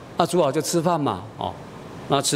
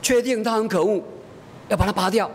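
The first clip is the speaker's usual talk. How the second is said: put-on little girl; 7 semitones higher than usual. Daughter insists on pulling tooth.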